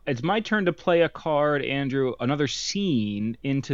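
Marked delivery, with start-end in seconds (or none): none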